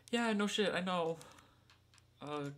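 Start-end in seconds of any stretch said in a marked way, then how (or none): none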